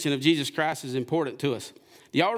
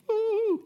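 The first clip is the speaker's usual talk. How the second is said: high joyful woo; joyful whoop. Real, not acted. Preacher whooping for a great day at church, joyful.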